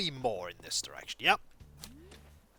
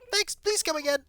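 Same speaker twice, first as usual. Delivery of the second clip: put-on much higher, fast, bubbly shop girl; cheery shopkeeper voice; 3 dB louder than usual. Shopkeeper greeting customer, high bubbly chatter. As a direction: chirp it like an overeager cartoon shopkeeper.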